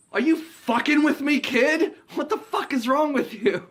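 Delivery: very high, loud outburst; exasperated yell. Retold or imitated. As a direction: explode at the kid. Willie loses it at kid.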